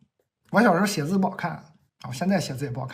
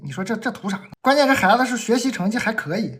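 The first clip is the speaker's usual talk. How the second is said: ordinary delivery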